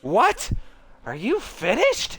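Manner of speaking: high, sharp indignant reply; indignant what. Acted. Girl called a coward, high and indignant. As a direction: bristle at the insult.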